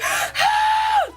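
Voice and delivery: very high, loud retold scream; piercing imitation of a panicked scream; freaked-out scream. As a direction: shriek in panic, acted out. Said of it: "and I scream, and I'm freaking out".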